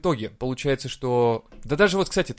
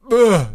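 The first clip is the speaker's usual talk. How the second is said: dumb grunting; 8 semitones higher, 11 dB louder than usual. Retold or imitated. Guy reacts dumbly to letter.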